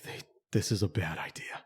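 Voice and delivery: unvoiced hushed whisper. Watching from the window, whispered aside. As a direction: breathe it, barely voiced. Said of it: "whisper heard unvoiced".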